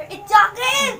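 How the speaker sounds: loud. Real, not acted. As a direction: call it out loudly. Eating challenge, repeats louder.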